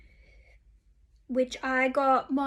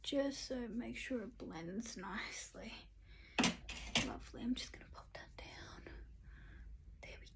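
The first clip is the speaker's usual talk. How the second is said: whispering; 2 semitones lower, 11 dB quieter than usual. Real, not acted. Whispered makeup tutorial.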